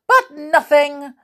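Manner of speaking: high, loud retort; cutting off. Acted. Stubborn listener cutting Mister Bump off, sharp retort. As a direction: shut the protest down. Stubborn character cuts off protest.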